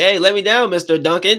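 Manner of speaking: put-on high, loud kid shouting to be let down; little boy pleading loudly to be let down; kid yelling. Little boy yelling at Mister Duncan to let him down.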